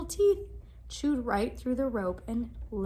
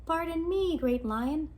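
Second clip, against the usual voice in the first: mouse voice; 5 semitones higher, 3 dB louder, slower than usual. Acted. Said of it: "said the mouse".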